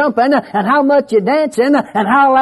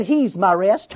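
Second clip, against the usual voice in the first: quiet, reverent reply; 6 semitones lower, 5 dB quieter, slower than usual. Retold or imitated. His tender reply to wife.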